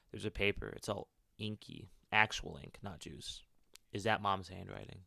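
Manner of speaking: steady storytelling read; story narration. Acted. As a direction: narrate the character's thoughts evenly. Reads game character's inner monologue.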